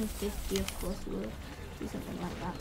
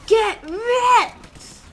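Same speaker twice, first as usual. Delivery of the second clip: higher, louder mock villain gloat in a put-on voice; gloating taunt; 7 semitones higher, 7 dB louder, slower than usual. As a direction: rub the win in, loud and cocky. Gloating taunt at defeated enemy.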